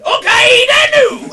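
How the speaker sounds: loud, high, hammed-up Scottish accent. Comic proving a sensitive Scottish accent, shouted broadly. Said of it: "I can actually do a sensitive Scottish accent".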